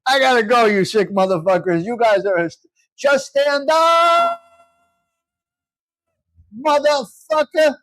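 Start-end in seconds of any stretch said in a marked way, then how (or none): 3.0-7.8 imitating the yell. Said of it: "That's what he said".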